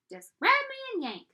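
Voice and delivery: high, loud tiny urging; high excited little voice; pigtail voice. Acted. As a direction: egg him on like a mischievous pigtail.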